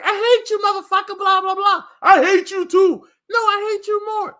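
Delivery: high, loud mock screaming woman; mock crazy woman. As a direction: scream like a furious girlfriend. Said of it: "She's like".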